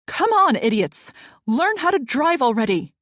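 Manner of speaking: loud, furious yell at other drivers; angry yelling. A narrator's reading. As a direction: shout it out in road rage. Road rage driver yelling.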